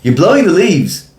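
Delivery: mock the neighbour's complaint over the fence; complaining neighbour. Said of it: "I'm not even gonna do the accent".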